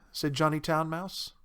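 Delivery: ordinary delivery, nothing marked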